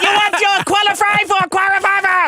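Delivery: put-on high, loud telemarketer accent; Indian call-center accent. Comic mimicking telemarketer calls, Indian call-center accent. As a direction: pitch a home loan as a scam caller.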